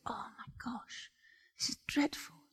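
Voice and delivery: hushed, horrified whisper; shocked whisper. Retold or imitated. Noel finding a body in a dumpster, horrified whisper. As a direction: breathe it out in shock.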